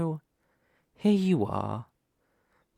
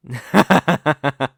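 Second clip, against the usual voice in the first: a loud scornful villain laugh; villainous laugh; 3 semitones higher, 8 dB louder than usual. Acted. Demon lord laughs at the naive hero, loud scornful laugh. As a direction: cackle at the hero's simple view.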